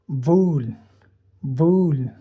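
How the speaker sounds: "वूल" begins with rounded lips, w and not b; rounded w sound. Shown as a demonstration. Teacher models wool as against bool. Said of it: "आप लोगों ने कह दिया कि ये बूल है, ये गलत है".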